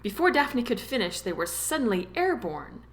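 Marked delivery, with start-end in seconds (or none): none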